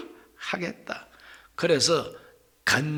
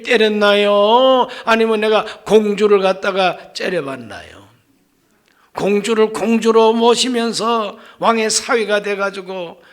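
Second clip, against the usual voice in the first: louder, pleading complaint to God; aggrieved outcry; 2 semitones higher, 5 dB louder than usual. Acted. David protests loudly to God against the king. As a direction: pour out the grievance, voice raised. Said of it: "내 이 분한 마음을 하나님께 다 토해놓는다".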